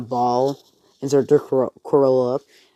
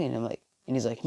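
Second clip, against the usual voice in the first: plain reading voice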